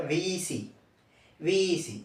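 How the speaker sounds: విఈసి said softly as visi, twice; soft recall. Shown as a demonstration. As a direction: quietly repeat విఈసి as visi. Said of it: "ఐదుని ఏమని చెప్పాను". (మెల్లగా విఈసి అని)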